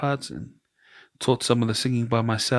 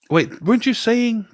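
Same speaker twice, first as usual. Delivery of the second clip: high-pitched, quoting a friend's question; retold eager question; 12 semitones higher than usual. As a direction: pipe up as the friend, much higher.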